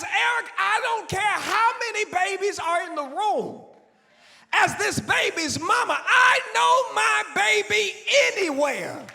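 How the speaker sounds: high, loud, tearful declaration; fierce mother's certainty. Retold or imitated. Aunt telling her nephew she knows her baby, tearful shout.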